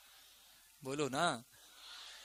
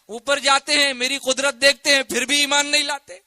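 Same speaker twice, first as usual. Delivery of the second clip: loud, high, impassioned challenge; fiery preaching; 6 semitones higher, 9 dB louder than usual. Real, not acted. Preacher asks why they still disbelieve, fiery and loud. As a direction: thunder the question at the crowd.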